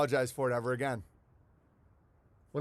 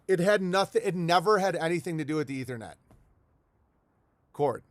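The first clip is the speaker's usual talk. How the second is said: ordinary talk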